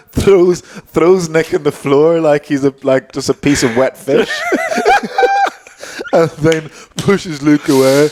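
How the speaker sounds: words breaking into laughter; cracking up mid-story; laughing while talking. Real, not acted. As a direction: tell it through real giggles. Laughing while retelling the fight.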